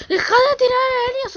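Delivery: high, loud whimpering plea; whiny scared cry. Real, not acted. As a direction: beg the spider to stop, half crying. Crying out at spider. (súplica aguda y llorosa)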